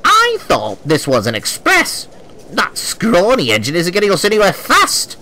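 high, sniping woman's voice; angry woman passenger. A narrator's reading. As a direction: sneer at the engine. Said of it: "added a woman".

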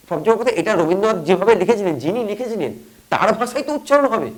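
ordinary talk, nothing marked

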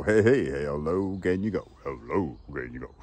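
low crooning sing-song; going low. Shown as a demonstration. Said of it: "I've got low".

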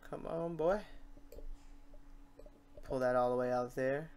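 ordinary talk, nothing marked

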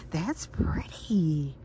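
slow admiring whisper; soft whisper. Real, not acted. Admiring a new set in a slow whisper. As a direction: linger on it softly.

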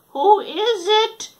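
high, loud, slow fake granny; wolf mimicking an old lady; wolf faking Granny. Wolf in grandma's bed faking Granny, high. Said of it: "in his best Granny imitation".

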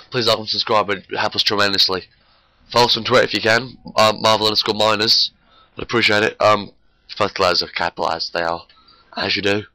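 usual speaking voice

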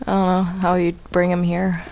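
usual speaking voice

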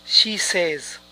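"says" as SEZ, short vowel; correct pronunciation. Shown as a demonstration. Teacher repeating the correction, "says" as SEZ. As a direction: say "says" to rhyme with "fez". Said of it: "esta pronunciación es incorrecta. Es".

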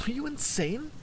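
angry. A narrator's reading. Angry Aldara scolds Arthur to flee the storm. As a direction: get him moving, fed up. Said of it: "angry already".

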